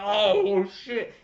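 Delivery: loud, high, drawn-out shocked cry; stunned outburst. Retold or imitated. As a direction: blurt it out in amazed shock. Acts out own stunned reaction.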